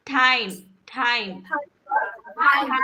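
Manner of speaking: टाइम with a breathy th at the start; aspirated t. Shown as a demonstration. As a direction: add a puff of air after the t in टाइम. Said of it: "टी के साथ जुड़ के एच का sound आता है". (टाइम में ट के साथ हवा)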